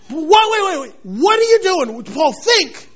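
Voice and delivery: high, loud alarmed protest; alarmed friends. Retold or imitated. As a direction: hold Paul back in mock alarm. Friends stopping Paul, alarmed.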